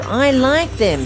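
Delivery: ordinary delivery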